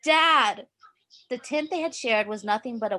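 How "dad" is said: high loud call for dad; frantic call. A narrator's reading. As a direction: yell out desperately for him. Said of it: "he coughed frantically and tried again".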